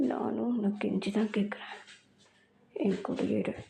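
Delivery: put-on low, whispered possessive boyfriend; possessive lover. Jungkook voiced low. (தாழ்ந்த குரலில் உரிமை கொண்டாடும் காதலன்)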